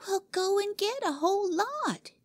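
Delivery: put-on high, bossy child's order; commanding little girl. Cora ordering Jimmy to the shed: bossy high girl voice. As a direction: boss him around. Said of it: "commanded the angel child".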